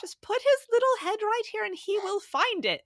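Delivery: put-on high, gentle Irish accent; Irish nurse impression. Mother imitating the Irish lactation consultant, high Irish accent. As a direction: coax like a kindly Irish nurse, pitched high. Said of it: "she goes".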